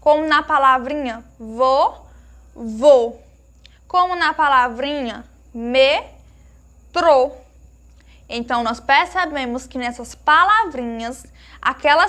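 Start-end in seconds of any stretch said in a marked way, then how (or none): 1.4-3.2 vou as "vô", closed long "ô"; closed vowel. Shown as a demonstration. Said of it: "em que o timbre é fechado". 5.5-7.3 metrô slowly split, closed "trô"; closed vowel. Shown as a demonstration. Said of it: "em que o timbre é fechado".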